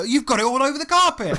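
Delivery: very high-pitched mock outrage; imitated scolding wife. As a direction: scold like a horrified wife, voice shooting up.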